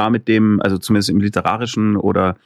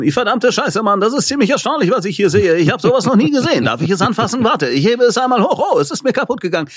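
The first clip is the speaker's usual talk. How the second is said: much higher, hammed-up stock dub voice with laughter breaking in; parody of German dubbing; 10 semitones higher than usual. Mocking stiff German dubbing for podcast guest, hammed-up high dub voice. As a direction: overact like a cheesy synchro actor. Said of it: "bei uns klingen halt dann die synchronisierten Leute".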